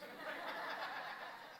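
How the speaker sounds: a soft airy laugh at himself; breathy real laugh. Real, not acted. Speaker laughing at the surprise he got. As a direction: let a quiet laugh slip out. Laughs at his own shock.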